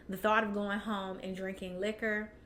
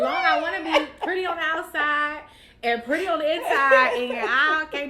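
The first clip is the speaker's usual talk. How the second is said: loud, high laughing talk; laughing as she goes on; laughing while talking; 7 semitones higher, 11 dB louder than usual. Real, not acted. Laughing at her own joke to the host.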